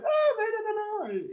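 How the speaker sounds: high, loud mock outcry; imitated protest. Guest quoting what everyone told her about Angers, high mock protest. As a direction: act out everyone's exclaimed objection. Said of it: "tout le monde m'a dit".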